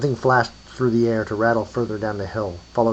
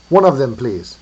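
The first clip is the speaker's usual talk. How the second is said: put-on louder Russian-accented request; 3 semitones higher, 7 dB louder than usual. A narrator's reading. Buyer wants one eyeball, Russian accent. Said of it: "said someone with a Russian accent".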